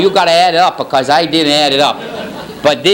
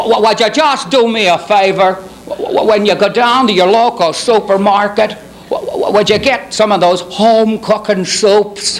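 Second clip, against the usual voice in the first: halting, folksy pitchman voice; stammering celebrity impression; 3 semitones higher than usual. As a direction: stammer into each request, pitch lifted a little.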